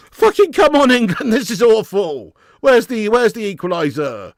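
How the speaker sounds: high, loud exasperated yell; real outburst at the team; frustrated shout. Real, not acted. Fan demanding an equaliser from England, frustrated shouting.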